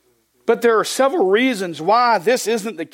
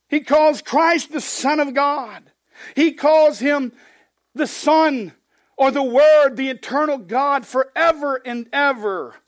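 raised, slow preaching emphasis; 7 semitones higher than usual. Acted. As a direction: drive home Christ's deity. Preaching at full force.

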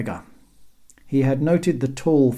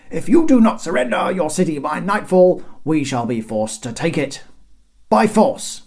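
loud, raised formal ultimatum; flustered herald; 6 semitones higher, 6 dB louder than usual. A narrator's reading. Herald threatening the teasing guards, flustered proclamation. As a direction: threaten the city, faltering slightly. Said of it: "discombobulated by the teasing and stumbled".

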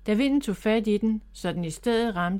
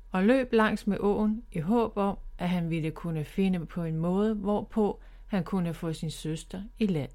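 plain reading voice

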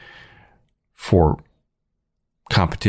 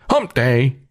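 high-pitched mascot call-out; camel catchphrase; 7 semitones higher than usual. Acted. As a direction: crow it like the ad camel. Imitates Geico's camel catchphrase.

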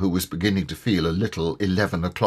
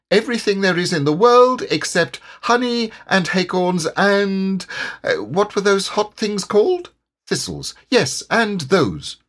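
bouncy Tigger; 9 semitones higher than usual. Acted. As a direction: reel off the list, then fumble for the word. Tigger voice in story.

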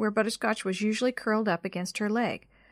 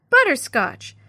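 loud, higher name call; shouting; 6 semitones higher, 8 dB louder, slower than usual. A narrator's reading. Jenny calls after her vanished cat, shouting. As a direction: yell the pet's name after it. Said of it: "she shouted".

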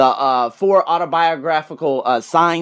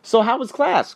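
higher-pitched, lightly acted parental question; approaching father; 7 semitones higher than usual. Father asking his son about school, lightly acted interest. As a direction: try gently to draw him out, as a dad. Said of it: "every time his father approaches him".

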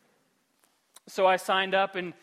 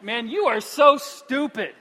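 angry at himself; 6 semitones higher, 10 dB louder than usual. Retold or imitated. Worn-out runner berating himself for signing up, angry. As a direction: scold yourself mid-race. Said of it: "I'm angry at this point".

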